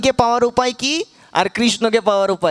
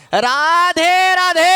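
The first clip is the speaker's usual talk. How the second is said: high, slow, louder drawn-out cry; louder shout; 8 semitones higher, 4 dB louder, much slower than usual. Shown as a demonstration. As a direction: push the call out for the crowd. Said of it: "আরো জোরে বলতে হবে". (আরও জোরে টেনে চিৎকার)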